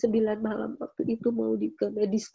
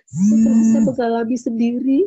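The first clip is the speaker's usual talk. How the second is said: impassioned declaration; 11 dB louder than usual. Real, not acted. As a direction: proclaim it to the whole church. Climax of testimony.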